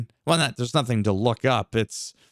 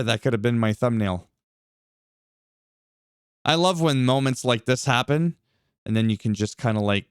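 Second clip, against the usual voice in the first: ordinary talk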